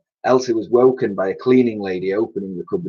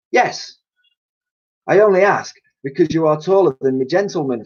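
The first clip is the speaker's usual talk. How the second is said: higher voiced dialogue; character voices; 7 semitones higher than usual. A narrator's reading. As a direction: play both characters. Dialogue of lady and girl.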